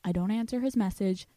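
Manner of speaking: ordinary talk, nothing marked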